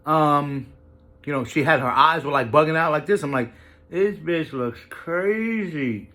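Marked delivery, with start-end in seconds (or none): 3.9-6.1 slow, quiet judging aside; skeptical inner thought. Retold or imitated. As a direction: drag it out like a private thought. Inner thought about odd girl.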